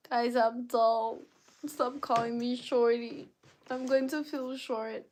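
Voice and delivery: high, whiny mock complaint; playful pleading. Real, not acted. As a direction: plead with them to stop teasing. Pleads against shorty nickname.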